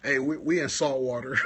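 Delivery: normal talking voice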